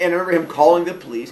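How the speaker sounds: ordinary talk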